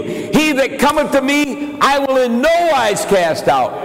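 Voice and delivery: full-force pulpit preaching; fervent preaching. Acted. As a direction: preach it out to the crowd.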